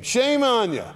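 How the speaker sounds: high, loud shame on you; stern preacher's loud rebuke. Acted. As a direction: cry out the rebuke.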